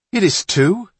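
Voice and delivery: high, loud contradiction; insistent. A narrator's reading. Johnny arguing back at Mullen, insistent. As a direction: insist like a squabbling child. Boys bicker over the bone.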